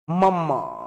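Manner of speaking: মাম্মা with a held m and long final a; drawn-out mam-ma for মাম্মা; doubled consonant. Shown as a demonstration. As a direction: lean on the mm of মাম্মা. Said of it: "মামা কি হবে?".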